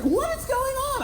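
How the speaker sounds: high-pitched, slow mock wife. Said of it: "She's like".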